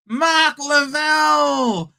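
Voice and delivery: slow, drawn-out high goal shout; excited shout. Real, not acted. Streamer calling a goal to viewers, loud and stretched. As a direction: call the goal like a commentator.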